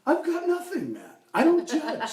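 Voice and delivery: high, insistent put-on voice; Tom Henry. Tom saying he doesn't judge, high protesting voice. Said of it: "with my Tom Henry".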